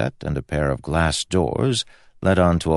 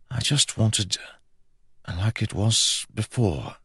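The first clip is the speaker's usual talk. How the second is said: higher, soft, halting confession; drowsy regret; 6 semitones higher, 4 dB quieter than usual. A narrator's reading. Prince drifting to sleep beside his brother, soft regret. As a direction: let it out slowly, with pauses.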